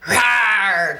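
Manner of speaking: growled lion rawr; throaty lion growl; lion roar. Shown as a demonstration. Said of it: "like in a lion".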